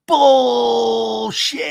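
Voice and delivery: long, high, loud holler; imitated drawn-out bellow; mock yell. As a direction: stretch it into a hammed-up shout. Retold lean-over yell in quarrel.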